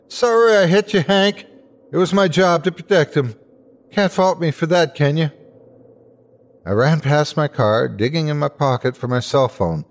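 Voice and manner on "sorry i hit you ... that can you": high-pitched yell from indoors. A narrator's reading. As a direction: call it out from inside the house, pitched well up. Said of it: "Mister Everett yelled from inside the house".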